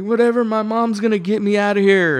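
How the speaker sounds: high, whiny imitation of a spoiled kid; bratty rich-kid protest; little rich kid. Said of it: "little rich kid".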